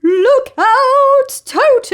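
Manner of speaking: high, loud warning shout; urgent cry. Acted. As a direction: yell the warning to the boy. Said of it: "cried Humpy Rumpy".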